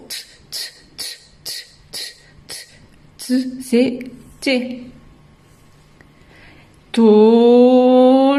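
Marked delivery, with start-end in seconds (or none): 0.1-3.6 "t" as a tiny voiceless tick, repeated with gaps; isolated first sound. Shown as a demonstration. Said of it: "qui ont compris le principe et qui vont dire". 6.9-8.4 "To" with a very long held o; end sound stretched. Shown as a demonstration. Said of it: "j'entends O à la fin".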